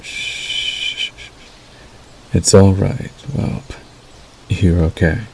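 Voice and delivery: hushed, tender wolf lulling a whelp; soothing werewolf. Acted. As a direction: soothe them gently toward sleep, with soft line endings.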